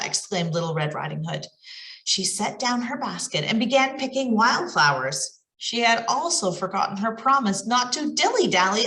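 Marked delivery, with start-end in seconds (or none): none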